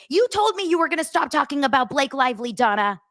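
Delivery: high-pitched mimicked telling-off; mock scolding yell. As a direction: imitate an indignant follower scolding her. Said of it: "that yell at me, that say".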